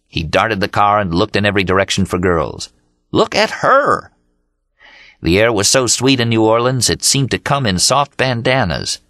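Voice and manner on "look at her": much higher, slow, thrilled exclamation; excited shout. Acted. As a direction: point her out with glee. Dean spots girls from car.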